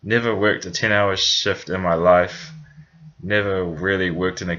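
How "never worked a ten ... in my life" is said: normal talking voice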